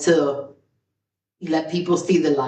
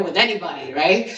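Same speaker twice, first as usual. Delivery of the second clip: usual speaking voice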